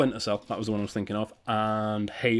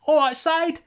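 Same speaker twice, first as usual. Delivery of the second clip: "Side" said the Cockney way, high and loud; hammed-up Cockney call; Cockney accent; 8 dB louder, slower than usual. Joking Cockney greeting to the singer [name]. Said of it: "Cockney, that's the word I was looking for".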